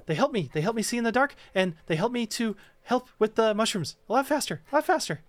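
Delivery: high, jittery fae voice; squeaky, wound-up pixie; frantic tiny creature. Acted. Quickling voice.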